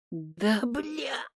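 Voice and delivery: usual speaking voice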